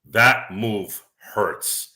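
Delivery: very slow, drawn-out quote; slow quoted line. Retold or imitated. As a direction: stretch each word slowly. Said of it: "he goes".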